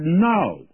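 "No" pitched high with a firm fall; a retold, emphatic "No". John answers the people questioning him, emphatic "No". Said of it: "He gives an emphatic no".

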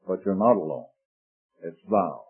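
soft, low and intimate; hushed tender. Acted. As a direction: drop to a tender hush. Tender turn to address God.

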